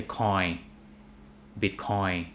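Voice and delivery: coin, Bitcoin as 'coy, bitcoy'; imitated learners' Bitcoin without its N; dropped final N. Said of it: "people cut the N out".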